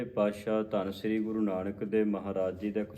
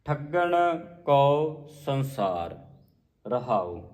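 slow, word by word; measured scripture recitation; 3 semitones higher, much slower than usual. Shown as a demonstration. Teacher modelling the refrain, slow measured recitation. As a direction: recite with long vowels, dropping on the last word.

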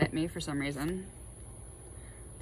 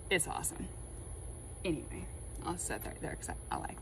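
whisper; 3 semitones lower, 8 dB quieter than usual. Real, not acted. Whispered aside about the cards.

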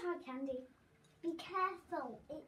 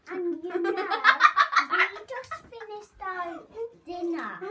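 laughing, much louder and higher; 6 semitones higher, 13 dB louder, slower than usual. Real, not acted. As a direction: laugh through the words. Laughing while eating sour candy.

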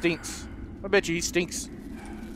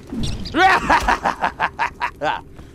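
evil laugh; 4 semitones lower than usual. Pretend. Villain laugh after kills.

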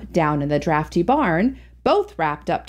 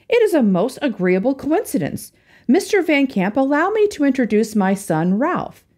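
pleased father; 6 semitones higher than usual. A narrator's reading. Father introduces son, pleased.

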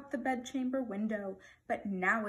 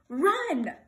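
loud, high royal order; sudden one-word shout; barked command; 6 semitones higher, 9 dB louder than usual. A narrator's reading. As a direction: bark it as an impatient king. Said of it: "barked the king".

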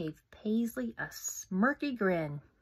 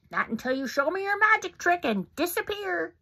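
higher, mocking bully voice; bully taunt; 7 semitones higher, 4 dB louder than usual. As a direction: taunt her.

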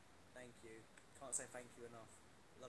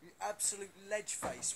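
hyped praise; 3 semitones higher, 3 dB louder than usual. Real, not acted. Hyping a fan's comment.

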